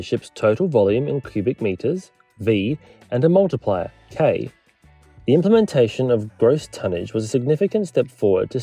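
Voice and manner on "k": normal talking voice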